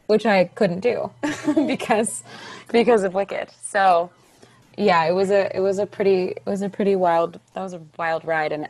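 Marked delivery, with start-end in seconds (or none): none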